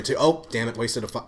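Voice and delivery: ordinary talk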